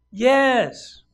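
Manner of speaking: high, loud emphatic yes; shouted confirmation; insistent God. Acted. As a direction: call it out firmly as God. God insisting, acted loudly.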